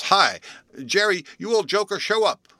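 loud, high, indignant call; indignant shout. Acted. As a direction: yell it up the tree, fed up with the joke. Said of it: "cried Bluff indignantly".

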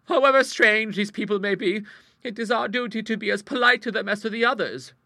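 high, loud prim spinster; prim old lady voice. Acted. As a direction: speak as a proper elderly lady. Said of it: "remarked Bertha".

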